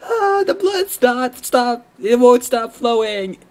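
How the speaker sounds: very high, slow mock panic; giddy fan panic. Fan overwhelmed by the gamer girl, high slow mock panic. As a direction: ham up being helplessly overexcited.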